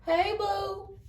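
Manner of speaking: high, loud, sweet "hey boo"; a playful come-on from the enemy; flirty high greeting. Retold or imitated. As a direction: flirt like a charming suitor. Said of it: "you finna go meet him".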